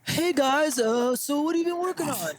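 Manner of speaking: Jake. Acted. Co-host imitating Jake to open the show, lightly put-on voice. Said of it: "is that your best Jake impress".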